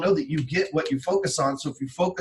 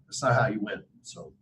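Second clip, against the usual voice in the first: usual speaking voice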